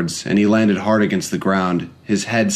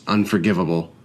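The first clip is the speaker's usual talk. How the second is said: plain reading voice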